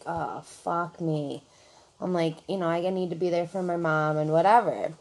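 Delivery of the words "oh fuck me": retold groaned aside. Dreading a move up front.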